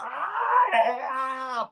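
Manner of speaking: a high anguished cry muffled into a pillow; retold anguished scream, muffled; stifled scream. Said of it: "y gritaba".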